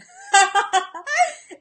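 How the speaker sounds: real amused laugh at a flubbed word; laughing at herself. Real, not acted. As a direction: laugh off the tongue slip. Laughs at her own slip.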